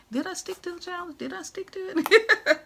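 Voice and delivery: high, quick mock questioning; playful self-quizzing. Vlogger quizzing herself on the challenge, high and playful.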